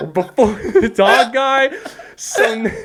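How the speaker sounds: real laughter breaking the words; words cracking up into high laughter; laughing through words. Real, not acted. Telling his friend about the border stop, laughing hard.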